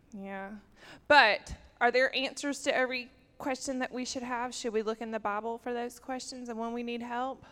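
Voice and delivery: ordinary talk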